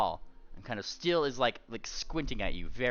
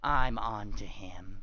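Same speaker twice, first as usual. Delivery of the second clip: suspicious threat; much slower than usual. Acted. Paranoid pony.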